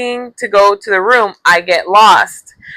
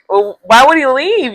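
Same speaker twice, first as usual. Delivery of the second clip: ordinary talk, nothing marked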